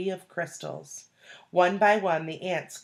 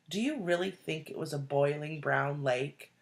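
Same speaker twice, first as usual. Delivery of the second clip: ordinary delivery, nothing marked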